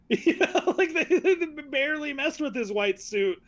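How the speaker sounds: high-pitched, talking through laughter; laughing. Real, not acted. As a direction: laugh your way into the complaint. Laughing about the villain's suit.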